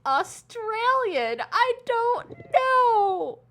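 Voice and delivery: put-on high, slow Australian accent; hammed-up Australian accent; mock Australian. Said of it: "speaking Australian".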